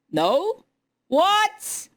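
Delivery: high, loud shocked what; incredulous what. Real, not acted. Viewer learns Steam prices exclude tax, shocked high what. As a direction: cry out in disbelief.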